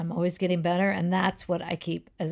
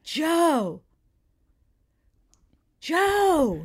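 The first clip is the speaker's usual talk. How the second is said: hollering; 7 semitones higher than usual. Acted. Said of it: "And he's hollering at Joe".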